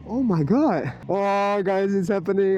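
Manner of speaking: normal talking voice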